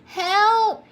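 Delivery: high, loud, drawn plea; cry for help. A narrator's reading. Stranded fish gasping for air, calling for help. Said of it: "he groaned feebly".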